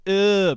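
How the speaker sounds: loud, high groan of revulsion; disgusted ugh. Retold or imitated. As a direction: re-enact recoiling from something gross. Retold reaction to gross film bits.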